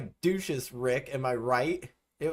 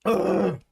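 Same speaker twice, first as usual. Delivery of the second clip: loud "ugh" of revulsion; disgusted groan; 5 dB louder than usual. Real, not acted. Streamer after eating a foul bean, loud disgusted groan.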